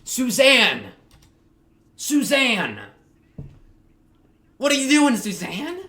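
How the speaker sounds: exasperated callout. Real, not acted. Calling out the character, exasperated.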